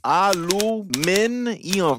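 "aluminium" drawn out slowly, a-lu-MIN-i-um; spelled-out aluminium. Shown as a demonstration. Correcting friends on the spelling, slow syllable-by-syllable "aluminium". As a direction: sound out "aluminium" piece by piece, ending ee-um. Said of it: "There's no M".

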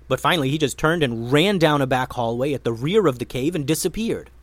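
ordinary delivery, nothing marked

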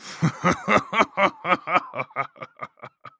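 long evil laugh, high then trailing off; rolling villain's cackle, dying away; evil laughter. A narrator's reading. Attacker leaving his victim in the snow, evil laughter. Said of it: "He laughed, a rich, deep, evil laughter".

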